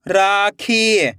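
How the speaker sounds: Rakhi joined as one slow word; blended word. Shown as a demonstration. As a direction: read rakhi whole, falling at the end. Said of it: "खींच के पढ़ना है".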